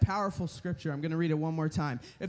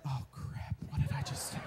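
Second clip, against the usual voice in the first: whispered, much softer self-reproach; panicked whisper; 6 semitones lower, 7 dB quieter than usual. Retold or imitated. Man who just volunteered whispering his regret to himself. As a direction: hiss it to yourself, instantly regretting it.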